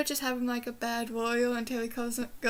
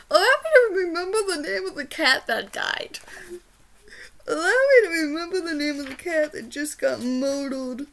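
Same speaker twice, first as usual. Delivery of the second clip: exasperated outburst; 4 semitones higher, 7 dB louder than usual. Real, not acted. Frustrated at forgetting the name.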